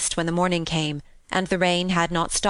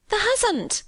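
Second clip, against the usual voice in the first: high-pitched Yorkshire disbelief; startled maid, pitch leaping up; startled exclamation. A narrator's reading. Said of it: "she exclaimed".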